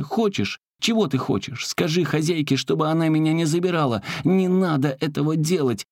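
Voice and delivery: high, urgent persuading; anxious pleading. Acted. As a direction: coax the ring in a hurry. Заколка уговаривает колечко. (высокая торопливая мольба)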